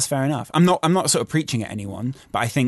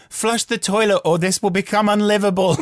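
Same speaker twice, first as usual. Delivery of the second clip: retold shouting; 7 semitones higher than usual. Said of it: "kept shouting through the door".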